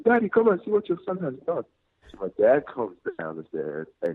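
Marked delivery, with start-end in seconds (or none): 0.0-1.7 high, shrill-pitched mother calling; high voice calling dad upstairs; mom calling out. Retold or imitated. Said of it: "She's like".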